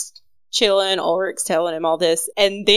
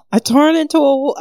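a hint of a higher, louder German accent; 8 semitones higher, 5 dB louder than usual. Ulrich confessing to the doctor, a weak German accent. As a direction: try a German accent and fail. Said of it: "I can't do a German accent".

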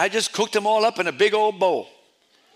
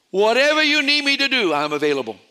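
eager offer; 4 semitones higher, 5 dB louder than usual. Retold or imitated.

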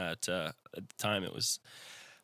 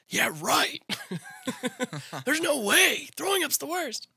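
much higher, loud mock whiny kid; sulky kid self; 5 dB louder than usual. Mocking his childhood self refusing mom's advice, high whiny. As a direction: squeak it out like a stubborn sick child. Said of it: "And I'm always like".

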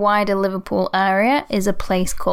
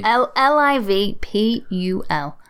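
slow letter by letter L I V P U L; careful spelling; spelled out slowly. Shown as a demonstration. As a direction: spell each letter deliberately. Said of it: "spelling old form".

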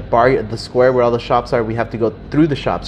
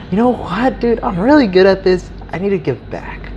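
self-pleased; 7 semitones higher than usual. Retold or imitated. Acts own self-pleased resolution.

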